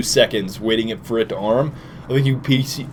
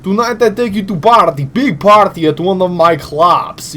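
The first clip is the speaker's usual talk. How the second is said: higher, put-on Russian villain voice; party-boy villain with a thick mock Russian accent; 4 semitones higher, 4 dB louder than usual. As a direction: do a hammy Eastern Bloc accent. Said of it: "that fluctuating Russian Eastern Bloc accent".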